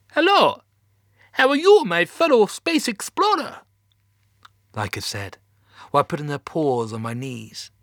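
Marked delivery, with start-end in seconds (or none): 0.1-0.6 loud, very high Russian dog voice; terrible Russian accent. A narrator's reading. Laika the space dog greets the astronaut, high terrible Russian accent. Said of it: "That's a terrible Russian accent". 1.3-3.6 loud, very high Russian dog voice; hammed-up bad Russian, pitched way up; terrible Russian accent. A narrator's reading. Laika the space dog to a fellow explorer, high terrible Russian accent. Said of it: "That's a terrible Russian accent".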